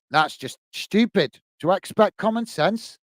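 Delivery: ordinary talk, nothing marked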